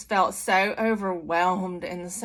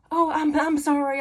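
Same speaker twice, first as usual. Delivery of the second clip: high, loud mock-panicked apology; hammed-up flustered pleading; anxious apology; 9 semitones higher, 5 dB louder than usual. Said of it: "like, anxious and".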